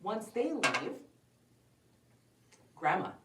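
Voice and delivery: usual speaking voice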